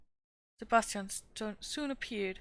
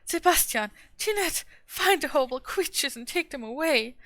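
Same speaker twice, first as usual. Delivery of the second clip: shrieking, much higher and much louder; terrified scream; 6 semitones higher, 10 dB louder than usual. A narrator's reading. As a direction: scream in horror at the cats. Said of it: "screamed Fräulein Rottenmeier".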